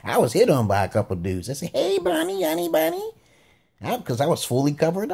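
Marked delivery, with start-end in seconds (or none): 1.7-3.1 high sing-out come-on, held up in pitch; mock catcall. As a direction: imitate dudes flirting in a high voice. Dudes hitting on him.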